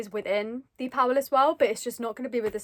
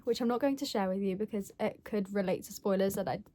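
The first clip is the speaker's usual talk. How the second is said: normal talking voice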